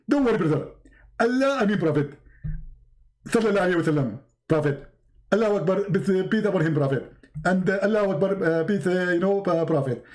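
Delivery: lisping preacher impression, pattering honorifics; Zakir Naik as Jibreel. Angel placates Muhammad, fumbling mock-pious preacher impression. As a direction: reassure him with mock-pious bursts. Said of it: "You look like Zakir Naik".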